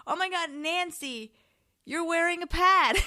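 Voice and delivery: high, loud shocked shout. Retold or imitated. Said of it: "And she shouted that".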